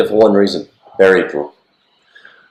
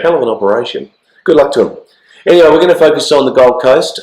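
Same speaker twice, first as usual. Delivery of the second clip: hoarse from the flu; croaky, flu-worn voice; 4 semitones higher than usual. Real, not acted. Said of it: "I will apologise for my, uh, croaky voice".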